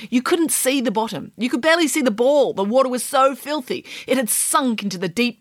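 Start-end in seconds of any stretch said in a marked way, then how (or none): none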